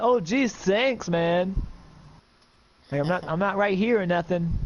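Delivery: sarcastic. Acted. Sarcastic friend's reply.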